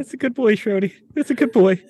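high, cooing pet talk; praising a pet. Acted. Owner praising the pet for its kill, high cooing.

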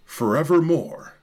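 hammed-up tragic recital, climbing to wailing highs; dramatic poem reading. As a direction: declaim it like a grieving gothic bard. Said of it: "your radio announcer voice or your Barry".

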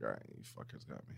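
fast unvoiced whisper. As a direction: whisper it under your breath. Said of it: "So I'm like".

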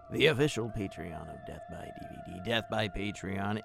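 ordinary talk

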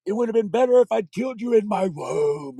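high, shrill mock scream, slowed; tearful screaming. Mother screaming at her son, high and bitter. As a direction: hurl it at the child in tears. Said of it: "screaming at me".

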